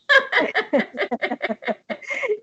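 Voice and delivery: high burst of laughter; hearty laugh. Real, not acted. Laughing at the joke. (carcajada aguda)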